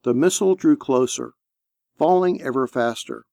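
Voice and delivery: ordinary delivery, nothing marked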